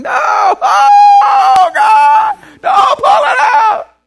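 very high, loud panicked shriek; child screaming in fear. Retold or imitated. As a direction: scream like a kid before the thorn is pulled. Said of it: "And you're like".

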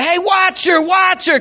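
high urgent retold shout; enthusiastic, authoritative. Rancher yelling to his helper as cow escapes, urgent. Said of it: "so enthusiastic and authoritative".

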